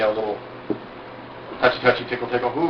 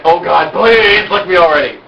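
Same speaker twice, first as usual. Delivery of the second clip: begging; 4 semitones higher, 5 dB louder, slower than usual. Retold or imitated. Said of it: "she is like".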